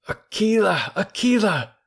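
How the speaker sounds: put-on excited cry to an old friend; calling out. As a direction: call him joyfully across the ground. Calling to the wolf.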